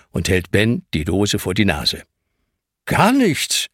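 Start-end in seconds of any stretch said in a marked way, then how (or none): 2.9-3.7 put-on defensive boy, much higher; boy defending himself to the stern man. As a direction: protest your innocence quickly. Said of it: "verteidigt sich der".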